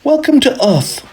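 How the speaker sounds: put-on high, bright girl's greeting; little girl voice. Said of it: "said Henrietta".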